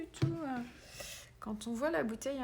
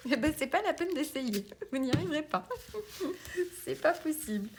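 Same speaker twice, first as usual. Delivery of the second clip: higher and laughing through the words; 6 semitones higher, 4 dB louder than usual. Real, not acted. Teasing control-loving viewers, laughing. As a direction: chuckle while telling them it's hopeless.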